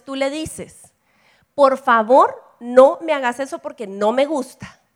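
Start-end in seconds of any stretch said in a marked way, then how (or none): 1.5-4.8 firm and loud, a clear boundary. Shown as a demonstration. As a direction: say it firmly so the sister stops. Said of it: "¿Viste mi tono?". (tono firme y fuerte, poniendo un límite)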